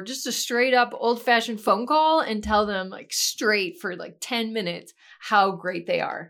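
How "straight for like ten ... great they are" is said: ordinary talk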